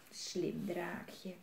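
ordinary delivery, nothing marked

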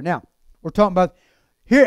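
usual speaking voice

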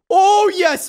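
high, loud burst of relief; triumphant cheer. Real, not acted. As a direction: cheer the successful jump. Makes the jump at last.

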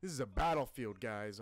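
loud awed battlefield shout. Real, not acted. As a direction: yell it out in awe. Shouting at the war scene.

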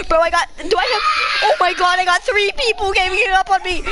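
high, loud, frantic yelling; panicked shout. Real, not acted. Gamer ganged up on by three, frantic yells. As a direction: shout like you're cornered. Three people attack him.

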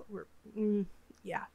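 ordinary delivery

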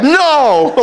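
high, sharp comic no; mock outburst. To the doctor in the lobby, a high mock refusal.

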